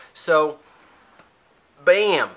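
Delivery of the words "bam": ordinary talk, nothing marked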